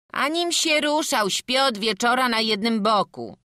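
high, loud protest; whiny high-pitched boy calling back; indignant youth. Acted. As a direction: protest your innocence at full voice. Boy loudly protests innocence.

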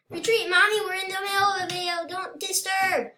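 urgent, very high plea; high-pitched protest. Real, not acted. Player tells mommy not to disturb the video, high-pitched protest. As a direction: plead in a high, pressing voice.

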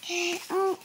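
normal talking voice

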